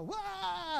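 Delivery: put-on high, sudden "wah" to startle kids; playful jump-out yell; mock scare shout. Dad jumping out to scare.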